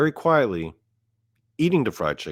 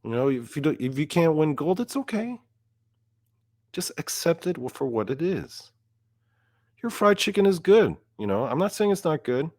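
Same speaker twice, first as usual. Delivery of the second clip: high, patronizing coaxing; sweetly condescending reassurance; mock consolation; 9 semitones higher than usual. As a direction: soothe a sore loser in a high voice. Consoling the runners-up with mock sympathy.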